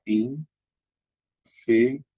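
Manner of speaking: infeliz split as in, fe, liz with long pauses; syllable by syllable. Shown as a demonstration. Teacher showing how infeliz divides, each syllable apart. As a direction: say "infeliz" very slowly, one syllable at a time. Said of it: "Então vai ficar".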